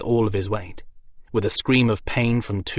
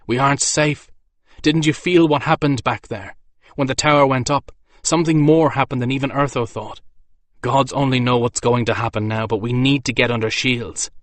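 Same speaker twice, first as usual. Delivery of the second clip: higher, louder pressing urgency; urgent warning; 4 semitones higher, 4 dB louder than usual. Acted. Soldier urging his friend toward shelter, higher and louder. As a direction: hurry him along.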